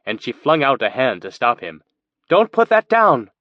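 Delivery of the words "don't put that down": much higher and louder alarmed cry. A narrator's reading. She stops Mateo dropping her bag, alarmed. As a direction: stop him in a panic. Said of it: "Her eyes widened in alarm".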